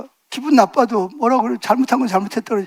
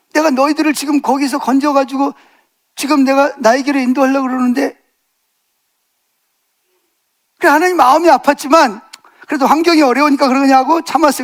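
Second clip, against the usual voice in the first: raised, urgent sermon delivery, pushing the congregation with questions; full-force preaching; 4 semitones higher than usual. Acted. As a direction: preach it hard, calling out to the pews.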